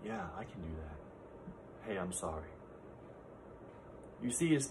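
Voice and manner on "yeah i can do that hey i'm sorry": gentle apology. Retold or imitated. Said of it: "I'm like".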